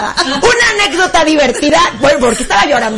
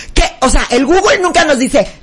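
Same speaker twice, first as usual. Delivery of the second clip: usual speaking voice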